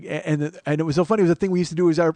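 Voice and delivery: ordinary talk, nothing marked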